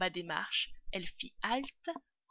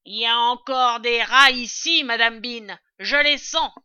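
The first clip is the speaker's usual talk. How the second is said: very loud, raised voice; calling up; 4 semitones higher, 16 dB louder than usual. A narrator's reading. Servant smelling rats in the cellar, calling up. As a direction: holler the complaint upstairs.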